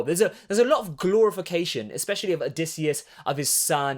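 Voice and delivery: normal talking voice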